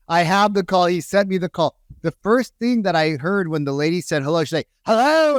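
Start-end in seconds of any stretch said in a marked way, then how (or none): 4.8-5.4 high-pitched mock aggressive phone answer; super aggressive. Expired listing lady answering a call, mock aggressive. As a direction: imitate a prospect pouncing on the phone. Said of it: "and she was super aggressive".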